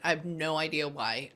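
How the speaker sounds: ordinary talk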